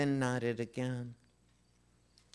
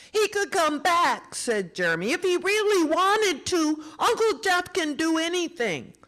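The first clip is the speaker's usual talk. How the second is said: put-on insistent child; 6 dB louder than usual. Boy insists tearfully.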